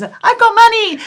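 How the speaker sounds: high, loud burst of delight; gleeful cheer. Retold or imitated. Showing the boys her cash, high loud glee. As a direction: crow it to your friends.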